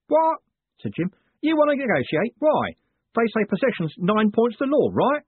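indignant. Acted. Jim indignant at the deal.

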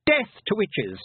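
put-on high-pitched boyish war cry; battle cry. A narrator's reading. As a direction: cry it out as you charge.

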